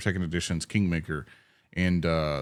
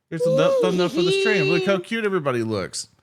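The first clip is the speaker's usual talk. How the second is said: higher, louder excited squeal; 9 semitones higher, 5 dB louder than usual. Real, not acted. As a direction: gush over the thumbnail. Excited showing thumbnail.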